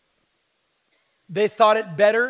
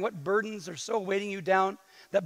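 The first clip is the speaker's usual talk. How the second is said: ordinary talk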